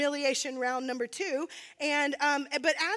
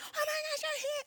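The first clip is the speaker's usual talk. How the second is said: obnoxious excited greeting; 3 dB quieter than usual. Retold or imitated. Greeting long-missed friends in a bar, high obnoxious squealing. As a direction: imitate your own over-excited reunion squeal. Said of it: "it's obnoxious".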